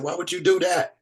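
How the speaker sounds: high-pitched imitation of a scolding wife; exasperated wife, voiced high; mock scolding. Wife scolding him, retold.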